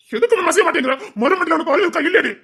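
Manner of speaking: exasperated lover scolding. Acted. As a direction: scold her in disbelief.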